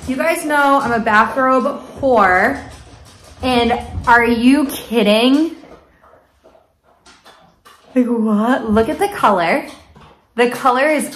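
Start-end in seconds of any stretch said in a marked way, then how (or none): none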